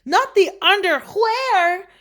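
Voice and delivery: high, slow, loud disbelief; exclaiming. A narrator's reading. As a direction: question it in shocked high pitch. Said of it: "exclaimed Scipito".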